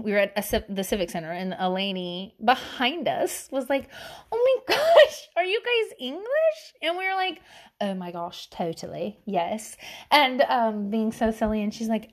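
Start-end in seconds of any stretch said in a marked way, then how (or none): 4.3-6.7 high-pitched, gushing question; mock-amazed, drawn-out high squeal; amazed stranger. Retells amazed lady behind them. 7.8-9.8 low, soft put-on British; a child's hint of British, played cool; casually faked British accent; fake British accent. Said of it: "We were talking in a British accent".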